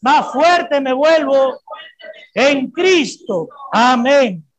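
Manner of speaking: slow, loud, rising proclamation. Shown as a demonstration. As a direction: build it up and hit fuerte. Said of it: "Una vez más".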